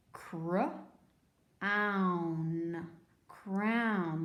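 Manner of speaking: "Cr-own" in two parts with a long gap, then "crown"; sounding out. Shown as a demonstration. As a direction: break "Cr-own" after the first sounds, then blend "crown". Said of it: "So that's the ow sound".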